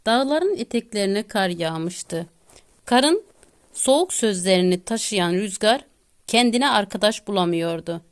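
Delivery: ordinary delivery, nothing marked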